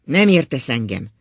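loud, angry protest; a loud, angry outburst at the wife; heated. A narrator's reading. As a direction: fire it back hotly. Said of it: "felelt az öreg indulatosan".

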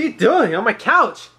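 laughing disbelief at what's onscreen; shocked. Real, not acted. Finds friend on couch.

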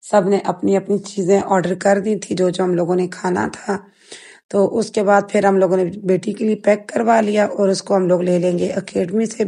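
usual speaking voice

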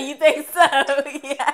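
high, loud, laughing through the words; high loud flattered giggle. Real, not acted. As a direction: giggle it out, pitch up.

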